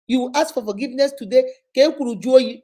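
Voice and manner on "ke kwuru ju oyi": loud mocking plea; mock apology. Mocking cheating husband, loud plea. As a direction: parody the repeated apology.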